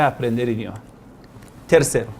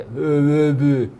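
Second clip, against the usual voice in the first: put-on baby babbling; slower than usual.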